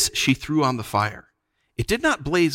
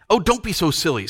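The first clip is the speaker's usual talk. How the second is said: put-on higher-pitched impatient boy; exasperated protest; 7 semitones higher than usual. A narrator's reading. Scrub fighting the enchantment, exasperated. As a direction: brush off the nonsense.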